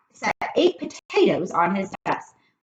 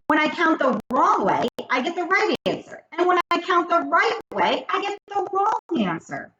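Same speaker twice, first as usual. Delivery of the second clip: put-on high, eager kid voice; pleading little boy, fast and high; Joe's voice; 12 semitones higher, 3 dB louder than usual. A narrator's reading. Joe begs the teacher to let him go to recess, high eager kid voice.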